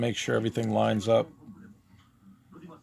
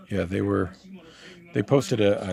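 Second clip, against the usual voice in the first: ordinary delivery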